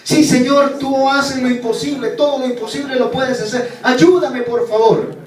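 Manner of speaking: the hanging climber answers the Lord's voice, pleading; eager pleading. Acted. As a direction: insist that he believes. Man pleading with God.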